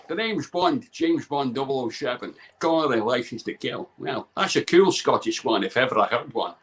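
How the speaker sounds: slow, low Scottish James Bond; deliberate Scottish secret-agent accent. Shown as a demonstration. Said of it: "It's gotta be Scotland".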